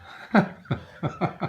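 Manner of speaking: a breathy, rising chuckle; airy laugh. Real, not acted. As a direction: laugh at what's coming. Laughing before punchline.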